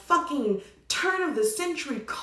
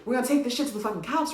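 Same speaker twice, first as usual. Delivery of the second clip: normal talking voice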